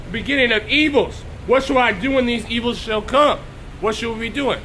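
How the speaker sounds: ordinary talk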